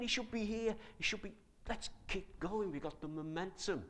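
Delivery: worried disciples hunting for Jesus, excited. Retold or imitated. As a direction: fret about where he's gone. Disciples searching, voiced excitedly.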